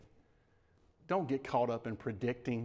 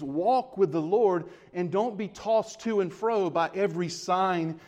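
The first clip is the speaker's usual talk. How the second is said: raised preacher's cadence; preaching; 4 semitones higher, 3 dB louder than usual. Acted. Preacher urging his church to trust the Lord, raised voice. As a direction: preach it out to the congregation.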